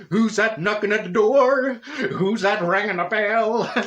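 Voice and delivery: loud, high, drawn-out singsong questions; excited for her. Retold or imitated. Teasing Senga about lottery callers at the door, excited. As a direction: call it out slowly with gleeful anticipation. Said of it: "getting all excited for her".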